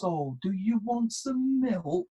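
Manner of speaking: a hint of a parent cooing to a toddler; doting parent. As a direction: gently model the full sentence back to the child. Parent recasting child's words.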